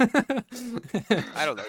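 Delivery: laughed out, high and loud; laughing. Real, not acted. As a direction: barely get it out through laughter. Laughing after the nerd joke.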